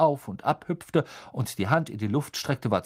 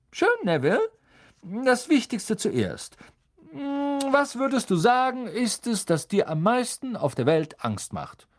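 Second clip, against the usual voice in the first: kind teacher; 13 semitones higher than usual. A narrator's reading. Teacher explaining the spell to the class, higher and friendly. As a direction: explain patiently like a gentle teacher. Lupin asks Neville.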